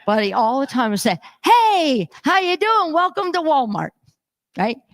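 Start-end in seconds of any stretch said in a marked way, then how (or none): 1.4-3.9 eager store greeter. Retold or imitated. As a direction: imitate a greeter trying hard to welcome everyone. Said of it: "he tries so hard".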